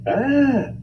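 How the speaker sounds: sudden shrill scream of fright; high startled yell. Acted. Father scared by painted face, high startled scream. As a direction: yelp in terror.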